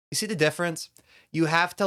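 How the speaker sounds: ordinary delivery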